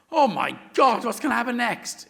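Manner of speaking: loud high excitement; thrilled. Retold or imitated. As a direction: gasp with thrill. Thrilled moviegoer.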